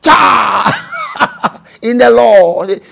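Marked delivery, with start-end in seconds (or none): 0.0-1.6 rebuking shout. Shown as a demonstration. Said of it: "rebuking the devil".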